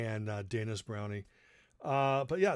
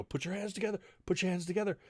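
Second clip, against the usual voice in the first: dad urging; 6 semitones higher, faster than usual. Retold or imitated. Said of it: "he'd go".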